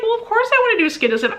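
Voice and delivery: high, rapid mock exasperation; exasperated retort. Retorting to husband.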